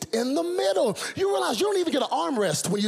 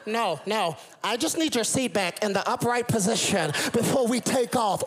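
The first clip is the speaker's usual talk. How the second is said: stewardess explaining; 2 semitones higher than usual. Retold or imitated.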